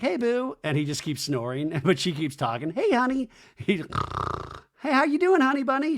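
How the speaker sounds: high, cooing mimic; mock girlfriend waking him gently; mock sweet wake-up. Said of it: "She's like".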